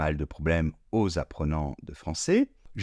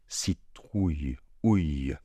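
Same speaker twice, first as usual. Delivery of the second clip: slow syllable by syllable; 4 semitones lower, slower than usual. Shown as a demonstration. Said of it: "On peut décomposer comme ceci".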